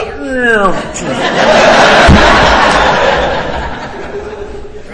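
loud breathy laughter; real laughing fit; long loud laugh. Real, not acted. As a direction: roar with laughter. Laughs after punchline.

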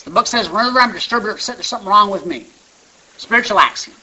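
high, loud, worked-up protest; mock indignant insistence. Upset man citing the book to his sponsor's wife, mock indignant insistence. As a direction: insist loudly, hamming up the upset.

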